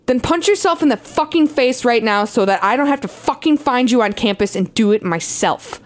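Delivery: normal talking voice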